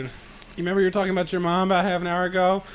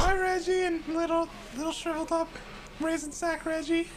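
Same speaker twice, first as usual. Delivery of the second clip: very high, soft falsetto; mocking girlish singsong; teasing girls' team; 13 semitones higher, 7 dB quieter than usual. Girls' team mocking the shriveled diver, girlish falsetto.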